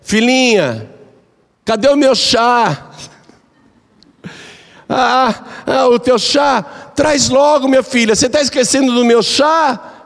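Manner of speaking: put-on high, sing-song old woman asking for tea; sweet demanding granny voice; fond old mother-in-law. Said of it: "Aí a sogra dizia". (sogra carinhosa, voz aguda de velhinha)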